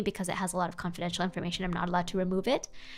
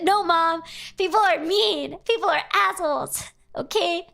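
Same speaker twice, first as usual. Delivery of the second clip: higher and louder, arguing back at mom; exasperated retort; 7 semitones higher, 4 dB louder than usual. Retold or imitated. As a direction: set Mom straight, fed up.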